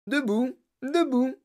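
high, gleeful calls to get up; merry cry. A narrator's reading. Inventor rouses the fallen passengers, crying out merrily. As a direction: call out cheerily, twice. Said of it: "cria monsieur Wonka en s'esclaffant".

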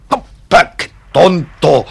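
loud, slow "ah, how silly of me"; a loud, slow burst of self-scolding; excited realization. Acted. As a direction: slap your forehead out loud. Suddenly remembers where it is.

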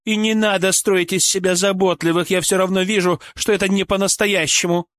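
высокий, упрямый женский голос; упрекать высоким голосом, с обидой; обиженная Ольга, раздражённо. Acted. (раздражённо, с упрёком)

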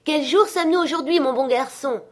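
put-on eager high call to a boy. A narrator's reading. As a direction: repeat the question, pitch well up. Repeats his call to the boy. (appel enjoué, voix aiguë)

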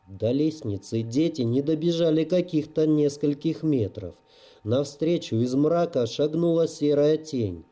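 ordinary delivery

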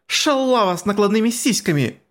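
put-on high angry girl's yell; high shrill insult. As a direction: spit out the insult, high-pitched. Girls' quarrel, insult hurled.

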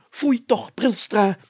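tut-tut outrage; mayor exclaiming. A narrator's reading. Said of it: "riep de burgemeester uit".

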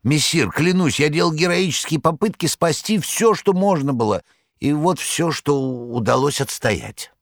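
high, fussy tomcat voice pleading his case; a boastful cat protesting his heroics, high; cat Behemoth. A narrator's reading.

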